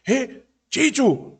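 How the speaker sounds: drawn-out high call after someone. Acted. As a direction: call him back, too late. Leader calls after fleeing companion.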